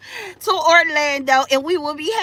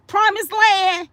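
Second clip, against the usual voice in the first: usual speaking voice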